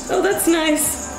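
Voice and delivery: usual speaking voice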